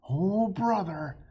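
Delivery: high, loud, slow groaning sigh of annoyance; hammed-up fed-up groan; exasperated mutter. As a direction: drag out the exasperation. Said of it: "muttered Jack".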